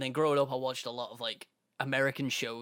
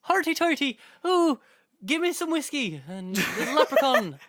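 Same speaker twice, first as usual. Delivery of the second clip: hammed-up leprechaun Irish voice; mock Irish accent; 11 semitones higher, 5 dB louder than usual. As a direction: squeal it as an Irish caricature. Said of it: "that's not an Irish accent".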